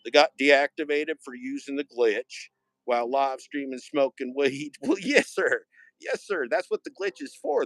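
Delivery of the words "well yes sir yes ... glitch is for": much higher folksy Yes, sir; folksy old-timer. Acted. Streamer mocking the glitch rule as a folksy old-timer, high. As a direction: crow it like a tickled old cowboy. Folksy cowboy character again.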